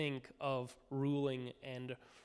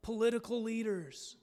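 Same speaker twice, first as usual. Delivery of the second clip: higher pleading prayer voice; crying out to God; 6 semitones higher than usual. Retold or imitated. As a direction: beg the Lord for rescue. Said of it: "we cry out".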